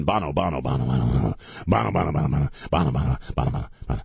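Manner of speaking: machine-gun run of the same name; rapid-fire repetition. Shown as a demonstration. Host mimicking a sudden flood of [name] news, rapid-fire repetition. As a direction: rattle the name off in rapid, falling bursts.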